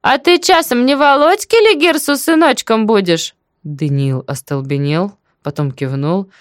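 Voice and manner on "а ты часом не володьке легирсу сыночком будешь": high, nosy village-aunt voice; high inquisitive neighbour; chatty deaf auntie, curious. Acted. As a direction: ask eagerly, pitched well up. Story figure: aunt questions inspector.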